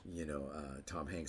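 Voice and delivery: ordinary talk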